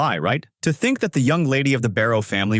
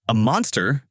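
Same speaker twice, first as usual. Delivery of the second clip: ordinary delivery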